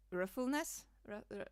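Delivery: normal talking voice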